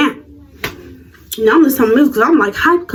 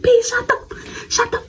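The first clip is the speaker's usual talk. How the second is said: laughing; 12 semitones higher, 6 dB quieter, slower than usual. Retold or imitated. Laughing breaks the line.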